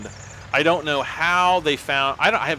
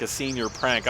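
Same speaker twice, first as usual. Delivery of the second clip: ordinary talk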